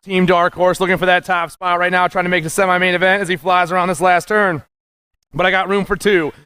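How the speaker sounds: ordinary talk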